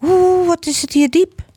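high, wobbly eaglet exclamation; alarmed cry. A narrator's reading. Little eagle looking down from the nest, alarmed cry. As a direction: draw out a high, frightened ooh. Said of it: "riep hij".

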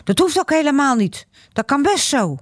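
put-on high, heated child's protest; a boy angrily defending himself to his mother; furious boy. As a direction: snap back at mum in a temper. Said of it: "Joran wordt woest".

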